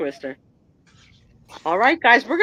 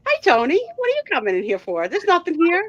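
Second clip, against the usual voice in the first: high sing-song pet talk; 9 semitones higher, 3 dB louder than usual. Real, not acted. Talking to the dog.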